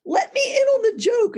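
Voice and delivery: high, insistent mock exasperation; exasperated plea. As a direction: beg to be let in on the joke. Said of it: "I said".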